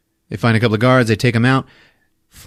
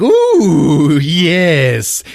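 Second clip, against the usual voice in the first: high, louder, greedy coo; gloating ooh; 8 semitones higher, 7 dB louder than usual. Acted. Wang getting the power pasties, a mock greedy coo. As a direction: savor it like a villain.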